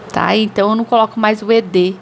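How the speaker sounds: usual speaking voice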